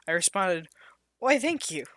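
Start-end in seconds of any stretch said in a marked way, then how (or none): 1.2-1.8 coy high voice. Acted. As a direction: answer sweetly, pitched up. Flattered heroine replies.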